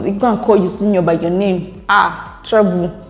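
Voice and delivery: ordinary talk, nothing marked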